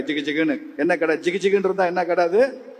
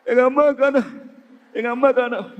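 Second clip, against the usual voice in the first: high, whimpering imitation of a boy; mock crying child; 5 semitones higher than usual. Lost boy answers the old man, high mock crying. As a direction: whine it as a pretend tearful child. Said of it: "ஏன் அழுற?".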